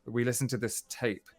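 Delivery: ordinary talk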